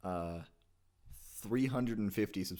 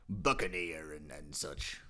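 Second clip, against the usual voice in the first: Mister Krabs; 4 semitones lower, slower than usual. Acted. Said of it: "To do Mister Krabs".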